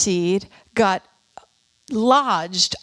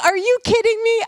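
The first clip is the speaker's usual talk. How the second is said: much higher and louder, amazed; retelling her amazed reaction to the neighbor; amazed disbelief; 13 semitones higher, 6 dB louder than usual. Retold or imitated. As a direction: replay the jolt of lucky surprise. Neighbor fixes cars, she's amazed.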